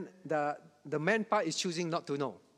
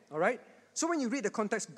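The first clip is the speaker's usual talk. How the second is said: usual speaking voice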